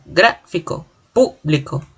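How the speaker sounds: "gráfico, público" broken after the stressed first syllable; teacher sounding out "gráfico, público", first syllables set apart. Shown as a demonstration. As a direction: hold "grá" and "pú", pause, then finish each word. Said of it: "se denota un acento en la escritura".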